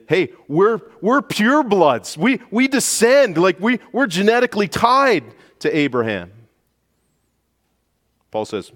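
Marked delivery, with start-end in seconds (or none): 0.1-6.3 strut like smug purebloods; imitating boastful opponents. Preacher voicing opponents bragging in a high loud boast. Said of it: "their boast would have probably been".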